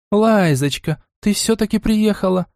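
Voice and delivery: high, bright joyful cry; joyful exclamation. A narrator's reading. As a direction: greet her with delight. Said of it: "радостно воскликнула женщина". (радостно воскликнула)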